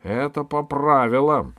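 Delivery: loud, high insistence; objecting. A narrator's reading. Jester contradicts the valet, objecting loudly. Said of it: "возразил шут".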